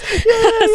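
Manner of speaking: high giggle; high laugh. Real, not acted. Laughing at own story.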